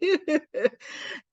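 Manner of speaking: a short laugh at herself; light laughter; amused laugh. Real, not acted. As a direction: laugh at the memory. Laughs recalling the session.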